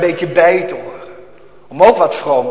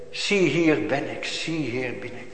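hushed tender words; 3 semitones lower, 8 dB quieter than usual. Pretend. Christ's words voiced softly.

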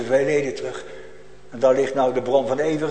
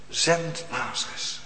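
softer, slow repeat of the demand; hushed echo; 6 dB quieter, slower than usual. Acted. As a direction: say it again as if far away. (zachte, trage herhaling)

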